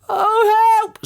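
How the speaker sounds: very high cry for help; panicked scream. A narrator's reading. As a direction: shriek for rescue. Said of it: "screamed Missus Twit".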